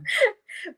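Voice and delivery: bright high-pitched laugh. Real, not acted. Laughs at kids remark.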